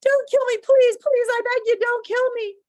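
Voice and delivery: high, loud frantic pleading; desperate high-pitched wail; begging for mercy. Acted. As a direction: beg for your life in a panicked voice. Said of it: "begging for mercy".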